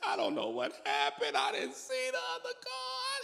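high, teary babbling; crying wife. Retold or imitated. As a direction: sob it out in a high voice.

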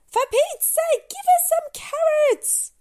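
high, loud, fed-up shout; a worn-out owner yelling at the wombat; exasperated human. Acted. Owner yells at the wombat for carrots, exasperated and loud.

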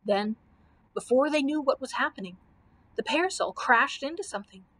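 ordinary delivery, nothing marked

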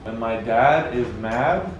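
feed the line carefully, word by word; careful prompt. Shown as a demonstration. Giving the phrase to a guessing friend, careful prompt.

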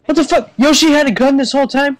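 high, startled outburst; shocked disbelief. Real, not acted. Player discovering Yoshi had a gun, shocked. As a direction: blurt it out in high-pitched surprise.

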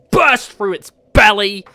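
normal talking voice

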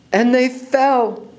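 high, very slow repeat; drawn-out suspense. A narrator's reading. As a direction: stretch the words out like a long fall. Repeat stretches the fall.

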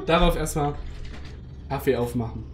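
ordinary delivery

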